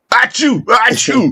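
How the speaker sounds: fake sneezes. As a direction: sneeze it out twice.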